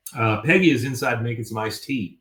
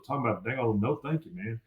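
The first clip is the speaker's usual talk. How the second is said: mumbling Boomhauer; 8 dB quieter, faster than usual. Retold or imitated.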